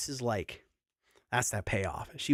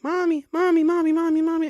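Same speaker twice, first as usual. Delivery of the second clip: put-on squealing toddler voice, much higher and louder; gleeful child's high-pitched chant; excited toddler; 6 dB louder than usual. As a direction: imitate a thrilled little girl calling for mom. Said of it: "and she's like".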